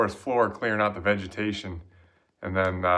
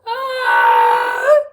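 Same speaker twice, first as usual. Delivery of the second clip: startled cry; 9 dB louder than usual. Real, not acted. Sudden startle while filming.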